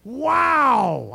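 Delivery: high, loud mock amazement; awed pastor. A pastor marvels at a colleague, high and awed. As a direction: gush like an impressed pastor. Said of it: "be like".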